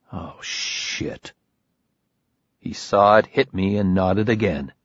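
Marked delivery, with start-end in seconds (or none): 0.1-1.3 breathy whispered dismay; realising he's been betrayed, whispered; whispered shock. Acted. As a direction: drop to a whisper as it hits. Narrator's stunned inner reaction.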